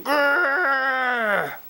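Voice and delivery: mock furious growl; imitated angry growl. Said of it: "He is going".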